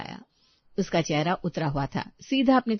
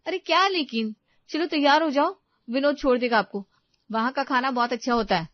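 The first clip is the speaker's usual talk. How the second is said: high, loud and pushy; impatient overruling; 6 semitones higher, 6 dB louder than usual. Acted. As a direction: cut off the objection and hurry them along. Daughter-in-law cuts off objection. (झुंझलाकर बात काटते हुए)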